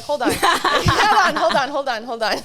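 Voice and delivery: laughing, frantic hold on to her parents; laughing panic. Retold or imitated. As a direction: stop them in a giggly panic.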